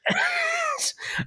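short real laugh. Real, not acted. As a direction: burst out laughing. Laughs after coughing.

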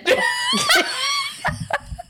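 high shrieking laugh. Real, not acted. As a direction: burst out in a shrill laugh. Big laugh after drinking.

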